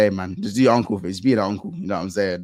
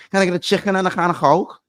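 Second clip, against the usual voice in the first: a light, higher Scouse accent on a stock order; 7 semitones higher than usual. Shown as a demonstration. Streamer showing his Scouse accent with a stock line. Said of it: "say the normal things that everyone says in Scouse".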